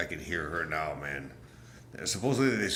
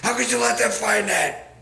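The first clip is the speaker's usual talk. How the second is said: shrill high falsetto yell, much higher and louder; mock screaming Hillary; 13 semitones higher, 7 dB louder than usual. Vlogger imitating Hillary screaming at her aide. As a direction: ham up a furious woman screaming. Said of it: "screaming and swearing".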